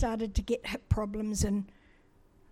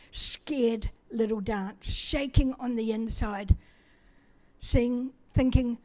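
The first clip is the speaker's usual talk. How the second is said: ordinary talk, nothing marked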